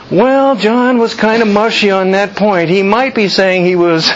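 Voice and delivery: a light parody of waffling; mushy hedging. Retold or imitated. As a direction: hedge it like an unsure messenger. Mocking vague report.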